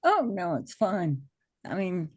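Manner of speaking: normal talking voice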